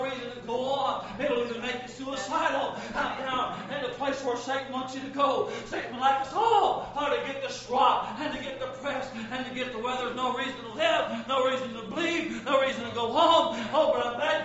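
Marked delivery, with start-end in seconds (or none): none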